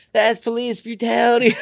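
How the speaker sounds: high-pitched imitation of a woman bystander; onlooker's outcry. Woman filming an arrest calls out in protest, high mock outcry.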